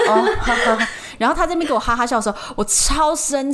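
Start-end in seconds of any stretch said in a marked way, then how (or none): 0.1-0.9 imitating a flat, faceless laugh; a dry mocking heh-heh; impersonating the boyfriend's cold chuckle.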